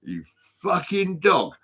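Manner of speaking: high, drawn-out jeer; retold angry insult. Retelling his comeback to the woman, angry high jeer. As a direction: throw the insult out high and slow.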